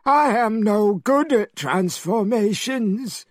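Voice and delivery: high whiny pig voice; Pigsy complaining. A narrator's reading. Pigsy complaining to Monkey about changing shape, high and whiny. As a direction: whine it like a lazy pig. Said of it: "complained Pigsy".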